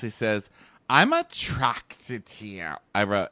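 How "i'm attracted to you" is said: slow mock nasal declaration; exaggerated nasal imitation; nasally. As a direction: imitate her nasal voice, drawing it out. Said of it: "they're having a really nasally conversation".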